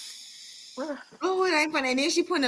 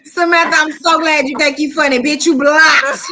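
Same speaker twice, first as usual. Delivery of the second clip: raised, forceful dismissal of a commenter; loud telling-off; 3 semitones higher, 8 dB louder than usual. Real, not acted. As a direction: announce the block at near shout. Mock-scolding a commenter, blocking them.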